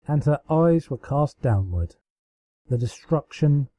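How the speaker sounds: ordinary delivery, nothing marked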